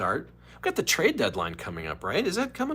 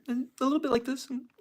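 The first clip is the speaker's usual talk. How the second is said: lightly voiced, higher news-anchor tone; a hint of the TV host, pitched up; Rachel Maddow impression. Streamer showing how Rachel Maddow talks, higher. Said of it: "I'm trying to do a Rachel Maddow impression".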